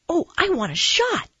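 put-on high-pitched, excited kid voice; eager child. A narrator's reading. Boy asks nurse for a shot, eagerly.

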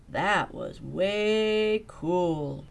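impressed drawl. Acted. Principal praising the migration, slow and impressed. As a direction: draw it out, really impressed.

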